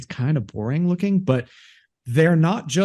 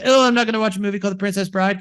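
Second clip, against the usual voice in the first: put-on nine-year-old boy; 7 semitones higher, much faster than usual. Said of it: "he's a nine-year-old boy".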